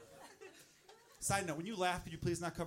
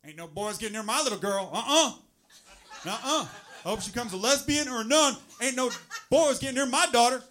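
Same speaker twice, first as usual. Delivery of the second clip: loud, raised Southern overprotective father; Southern dad; 5 semitones higher, 9 dB louder than usual. Acted. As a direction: bluster like a hammed-up Southern dad. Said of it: "I don't know why I make 'em Southern".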